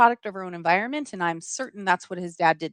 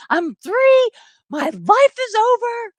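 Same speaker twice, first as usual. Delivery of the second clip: high, loud melodramatic wail; child freaking out; 13 semitones higher, 5 dB louder, slower than usual. Acted. Three-year-old fearing arrest, hammed-up panic. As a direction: cry it out like a tiny drama queen. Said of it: "I'm freaking out".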